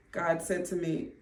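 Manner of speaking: usual speaking voice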